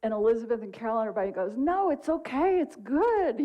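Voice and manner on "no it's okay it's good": high, upbeat retold reassurance; cheerful reassurance. Bereaved moms reassure the upset man, high and cheerful. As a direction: imitate friends brightly brushing off someone's worry.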